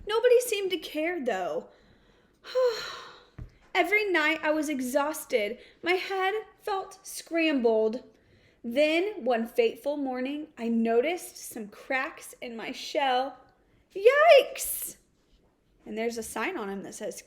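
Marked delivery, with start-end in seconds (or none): 2.5-3.0 long sigh sliding down from high; tired sigh. Acted. The ignored egg sighing to the listener, drained. Weary egg nobody cares about. 13.9-15.0 high, loud yelp of alarm; startled yikes. Acted. The egg spotting cracks in its shell, high loud yelp.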